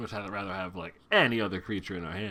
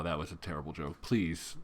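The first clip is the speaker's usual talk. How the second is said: normal talking voice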